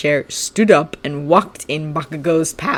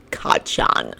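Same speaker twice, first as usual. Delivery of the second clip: whispered nickname, taunting; mocking whisper; 4 dB quieter than usual. Shinso whispering Bakugo's childhood nickname to taunt him. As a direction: breathe the name to needle him.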